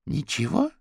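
high, rising questioning repeat; incredulous, lifted at the end; disbelieving echo. A narrator's reading. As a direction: echo it back as a surprised question. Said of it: "переспросила Сью".

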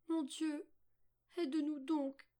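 put-on high, plaintive girl's prayer; pleading. A narrator's reading. Weeping Margot prays to God, high pleading. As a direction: beg for help in a high little voice. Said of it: "supplia-t-elle".

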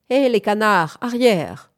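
shooing call. A narrator's reading. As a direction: shoo them back firmly. Shooing away the ducks.

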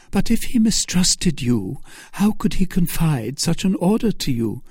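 high-pitched Milady voice, questioning; doubting Milady. Acted. Milady doubting Felton's story, high-pitched. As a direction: challenge him in a high voice.

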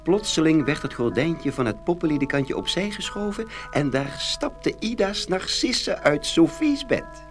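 ordinary delivery

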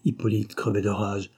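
ordinary delivery

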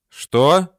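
puzzled, slightly startled query; uncomprehending. A narrator's reading. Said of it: "не понял Горохов".

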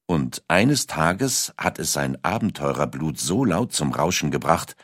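ordinary talk

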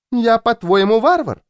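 high-pitched, affronted question; bristling indignation. A narrator's reading. Louisa bristles at being called a barbarian, indignant. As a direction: bristle at the accusation, pitch leaping up. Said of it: "ощетинилась Луиза".

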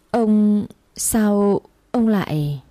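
slow, halting words of shock; frightened stammer. A narrator's reading. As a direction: falter in fear at being caught. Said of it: "miệng lắp bắp".

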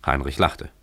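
usual speaking voice

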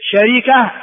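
شركة as sharika, kasra on the ra; original form. Shown as a demonstration. Teacher showing students the original form, شركة said sharika. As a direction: say شركة in three syllables, sha-ri-ka. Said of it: "هو الأصل".

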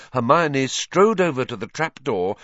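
ordinary delivery, nothing marked

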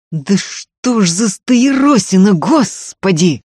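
slow, drawn-out furious exclamation; exasperated fury. A narrator's reading. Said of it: "зажмурился от бешенства Ефим".

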